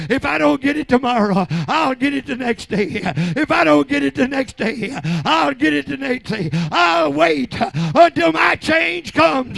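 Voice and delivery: high, loud shouted preaching; full-force preaching. Real, not acted. Preacher rousing the saints at night service, shouted preaching. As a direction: whip the church into fervor.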